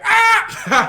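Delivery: loud, high burst of fake laughter; mock crowd laugh. Comic imitating an over-eager crowd, loud mock laugh. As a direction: shriek a laugh far too early.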